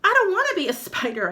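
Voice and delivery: high, loud and fast protest; frightened boy crying out fast. Acted. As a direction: cry out in terror. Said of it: "I cried".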